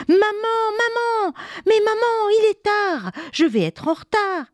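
put-on high, urgent child's voice; a little boy begging mum to wake up; panicked child. Léo réveille maman, paniqué. (enfant paniqué qui réveille sa mère, voix aiguë)

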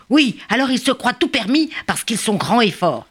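high, excited bird chatter; rally the gang for attack; eager little birds. Acted. Little birds plotting revenge.

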